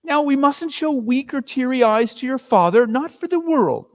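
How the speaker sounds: put-on steady yourself for the children; mother pulling herself together.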